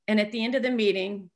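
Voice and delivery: normal talking voice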